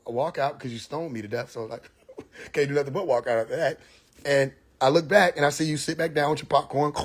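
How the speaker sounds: normal talking voice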